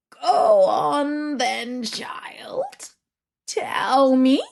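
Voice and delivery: put-on sleepy old witch daring the girl to fail; menacing witch. Baba Yaga threatens Vasilisa.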